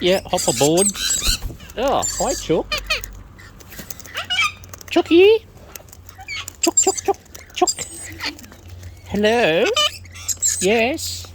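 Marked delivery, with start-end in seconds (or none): 4.9-7.7 high loud call; calling chickens. Real, not acted. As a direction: sing out to the chooks. Calling birds to come.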